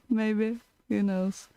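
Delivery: ordinary talk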